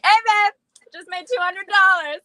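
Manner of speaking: bright, loud and high; excited. Pretend. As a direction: brag cheerfully to your husband. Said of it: "I'll be like".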